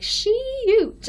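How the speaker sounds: high, loud, drawn-out Southern twang; imitated Southern accent. Imitating a friend's very Southern talk, exaggerated Southern accent. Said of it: "she makes it very Southern".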